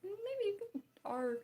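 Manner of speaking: high, slow, drawn-out reply; mock concession. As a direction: stretch it out high, playfully teasing. Mocking reply in acted dialogue.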